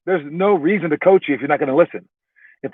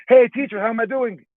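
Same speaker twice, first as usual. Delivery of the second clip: higher, eager little student voice; higher, eager student checking in with teacher; eager kid asking; 7 semitones higher than usual. Retold or imitated. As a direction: pipe up hopefully like a kid. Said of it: "and you go".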